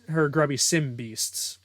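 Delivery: plain reading voice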